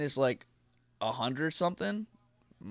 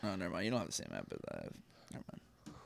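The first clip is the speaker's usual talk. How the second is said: muttered aside; 7 semitones lower, 12 dB quieter, faster than usual. Real, not acted. Muttering to himself.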